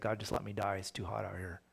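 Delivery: a touch of petulant complaint; mock tantrum. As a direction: sulk like a child in the heat. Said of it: "he has a temper tantrum".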